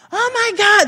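put-on very high, loud squealing teen voice; shrill mocking girl squeal; mock valley girl kids. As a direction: squeal it like gossiping schoolgirls.

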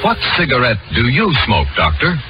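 usual speaking voice

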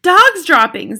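high, loud cry of alarm; shouting. A narrator's reading. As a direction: shout it like a whole crowd's alarm. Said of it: "they shouted".

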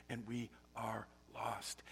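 whispered, slow and soft; breathy hush of being lost. Real, not acted. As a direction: let it fall to a whisper. Sermon, whispered dramatic line.

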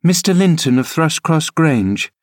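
put-on a girl urging her nurse uphill; eager young Cathy. Cathy answers proudly.

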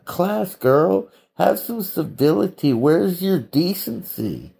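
high, slow, mock-disgusted scolding of a swearing girl. Vlogger scolds a girl heard swearing, mock disgust, high and slow. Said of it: "I was like".

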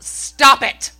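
high, loud burst of a yell; sudden yell. Retold or imitated. Mom yelling at her nine-year-old, sudden loud yell. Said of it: "I just yelled".

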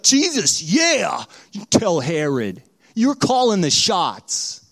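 loud, rowdy cheer; acted whooping approval, drawn out at the end. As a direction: egg him on like a fan, loud. Fan cheering Jesus on.

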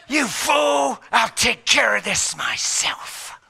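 loud, slow menacing yell; Satan taking over himself; furious shout. Acted. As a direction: roar it out with rage.

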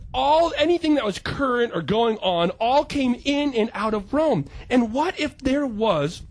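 ordinary delivery

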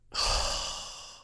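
long weary exasperated sigh. Shown as a demonstration. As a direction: let out a fed-up sigh. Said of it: "exasperated sigh".

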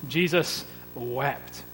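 Jesus wept said very slowly and softly; slow solemn emphasis. Shown as a demonstration. Preacher quoting the shortest verse to the church, slow and solemn. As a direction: let each word of Jesus wept sink in. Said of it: "shortest verse in the English New Testament".

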